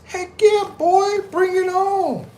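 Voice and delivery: high, drawn-out hype voice; reading a viewer's hype. Retold or imitated. Reading a viewer's chat comment, excited. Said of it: "Love Lacono says".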